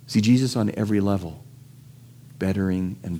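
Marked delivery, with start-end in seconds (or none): none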